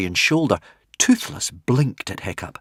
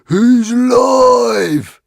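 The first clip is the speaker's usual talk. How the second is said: higher, louder, slow and drawn out; joyful shout; 13 semitones higher, 9 dB louder, much slower than usual. Acted. Very Vicious celebrating his pet, joyful shout. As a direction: bellow it in delight.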